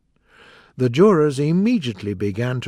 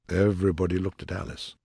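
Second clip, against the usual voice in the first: ordinary delivery, nothing marked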